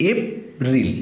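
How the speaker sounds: "April" broken in two, ep, then ril; syllable by syllable. Shown as a demonstration. Teacher dividing April for pupils, "April" syllable by syllable. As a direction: put a clear pause inside "April" between ep and ril. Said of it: "syllable ভাগ করে দিচ্ছি".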